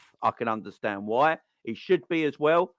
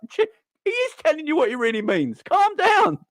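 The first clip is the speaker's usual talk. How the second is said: mock calm down; 3 dB louder than usual. Telling Klopp to calm down.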